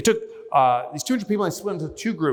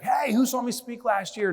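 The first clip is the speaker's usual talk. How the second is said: higher-pitched call to an audience; upbeat opener, raised pitch; retold crowd greeting; 9 semitones higher than usual. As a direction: hail the room with a question, pitched up.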